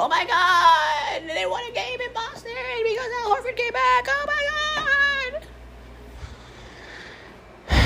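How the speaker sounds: high, hammed-up shout of a gloating Celtics fan; mock shouting fan. Podcaster mocking Celtics fans celebrating a win, in a shrill mock shout. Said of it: "They're gonna be like".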